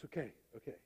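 soft, low, settled reply; real calm. Acted. Official reassured by the prime minister, suddenly calm and hushed. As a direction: drop right down, quietly accepting. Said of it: "the guy gets real calm".

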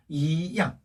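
"이" rising in second tone before a strong "양"; weak rising "이", strong falling "양". Shown as a demonstration. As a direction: say "이" rising, then drop firmly on "양". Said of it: "이성이었죠".

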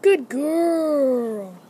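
put-on loud, long-drawn "good girl" to a pet; drawn-out animal praise, loud. Shown as a demonstration. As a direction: stretch out girl as a big reward. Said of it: "it needs to be in that tone".

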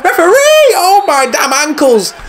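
high, drawn-out laughing shout at the referee; hammed-up injury wail; outraged appeal. Fouled player, appeal to referee.

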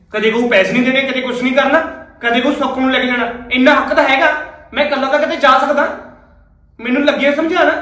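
loud, indignant ranting; angry rant. Acted. Husband loudly ranting about his wife's demands. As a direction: complain loudly about being lectured. Husband rants during quarrel.

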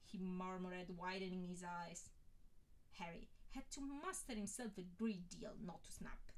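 usual speaking voice

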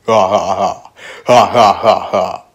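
forced, evenly spaced ha-ha-ha; punchy mock laughter; mocking fake laugh. Jeering at Josh.

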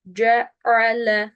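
"C A L" slow, with Arabic jim and ayn; correct Arabic articulation. Shown as a demonstration. Teacher repeats the model, slow Arabic "C A L". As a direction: give "C A L" each Arabic articulation point, slowly. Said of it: "Sırasıyla gelecek mahreçler".

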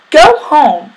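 loud, high storybook yell. A narrator's reading. Sammy orders Kevin out of the sandbox, yelling. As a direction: order him away at full voice. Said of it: "Sammy yelled, even louder".

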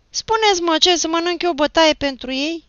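very high, loud scared protest; frightened voice. A narrator's reading. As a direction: cry out in fear of a beating. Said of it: "se auzi un glas speriat".

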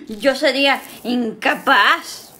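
slow, drawn-out fake denial; sarcastic slow "incapaz"; mock innocence. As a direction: lay the innocence on thick. Sarcastic denial after the gasp.

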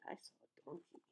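barely audible storytelling whisper. A narrator's reading. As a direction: whisper it before the big bray. Hushed build-up before loud bray.

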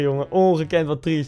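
normal talking voice

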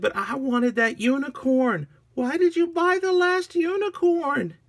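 very high, protesting wail; hammed-up childish whine. Agnes confronting minion over last unicorn, high whine.

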